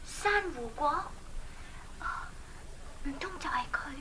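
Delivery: unvoiced whisper to herself; whispered aside. Princess whispering an aside to herself. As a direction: breathe it as a secret aside.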